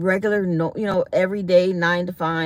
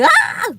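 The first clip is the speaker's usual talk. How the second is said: high, loud startled scream; scream on a bump; 6 dB louder than usual. Retold or imitated. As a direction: shriek as the wagon hits a pothole. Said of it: "We was like".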